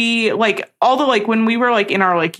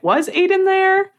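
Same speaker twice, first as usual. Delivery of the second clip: much higher, put-on questioning voice; mock-puzzled question pitched way up; high-pitched wondering; 12 semitones higher than usual. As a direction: ask it in a high, exaggerated voice. Acting out her own wondering question.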